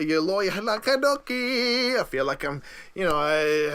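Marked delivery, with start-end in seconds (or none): 0.0-2.0 "Loyola Kadoki" drawn out slow and higher, Hawaiian-sounding. Real, not acted. Host fumbling Loyola Chicago, Hawaiian-sounding slip. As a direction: stretch "Kadoki" like a Hawaiian name. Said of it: "I'm sounding like I'm from, uh, Hawaii".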